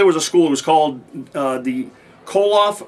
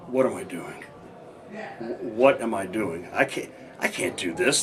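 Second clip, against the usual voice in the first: despairing inner thought; 5 semitones lower, 8 dB quieter than usual. Retold or imitated. Retold despairing thought in tub.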